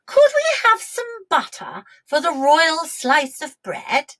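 a hint of a queenly request; lightly voiced queen. A narrator's reading. Queen asks dairymaid for butter, lightly regal.

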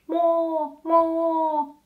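a gentler cow moo. Shown as a demonstration. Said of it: "That's the sound cows make".

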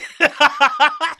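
high-pitched real laugh; amused laugh. Real, not acted. Host amused by the weak insults, high laugh. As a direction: burst out laughing.

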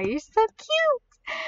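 very high, drawn-out gushing; slow, high-pitched cooing; adoring squeal. Real, not acted. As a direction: squeal over something adorable. Gushing over the new pet.

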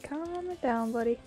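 ordinary talk, nothing marked

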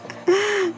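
quick laugh. Real, not acted. Joke about thunder.

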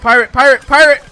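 loud, high, repeated shout; urgent enemy callout. Real, not acted. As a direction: yell the warning to your team. Calls out enemy to teammates.

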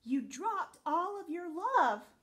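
slow, loud call after someone leaving. Acted. Dog calling after her friend who flew away, loud slow call. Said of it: "Olive called out".

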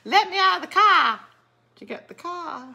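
high-pitched, hammed-up Australian accent; mock Australian accent. Quoting a woman in Days of Thunder, mock Australian accent. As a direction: do a film line in broad pretend Australian. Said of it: "kind of like a Australian accent".

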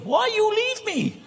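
very high, slow, hammed-up Greek accent; Greek mother impression. Preacher imitating a Greek mother begging, high and slow. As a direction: ham up a wailing Greek mum. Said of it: "My Fat Greek Wedding".

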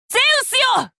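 ゼウスへの叫び. Acted. Calling on Zeus in battle.

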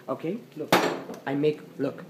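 normal talking voice

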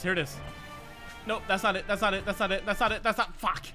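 ordinary talk

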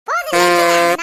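loud sharp order to stop; stop shout. Acted. Wife yelling at mother-in-law to stop, loud. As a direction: shout them down.

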